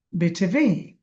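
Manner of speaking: BTV with the final ভি drawn out; stress on last letter. Shown as a demonstration. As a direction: lean hard on ভি in BTV. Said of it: "বিতে জোর হবে".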